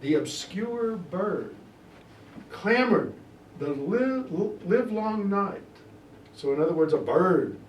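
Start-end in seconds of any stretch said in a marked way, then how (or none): 0.0-5.6 dramatic reading. Acted. Teacher performs Lennox's speech for the class with dramatic weight. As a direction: declaim it like a stage actor.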